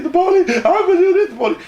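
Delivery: high, blubbering imitation; exaggerated crybaby begging; mock crying. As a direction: whimper a pleading mock sob. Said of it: "He was crying, I mean, like a bitch".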